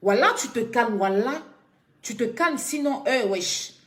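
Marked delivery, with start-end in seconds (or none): none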